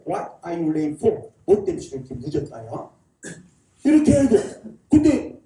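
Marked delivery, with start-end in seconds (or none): 0.1-1.3 very slow, separated English words; drawn-out regional phrasing. Shown as a demonstration. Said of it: "전라도 가니까". 1.5-2.9 lightly put-on Jeolla dialect, pitched low. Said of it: "전라도 가니까".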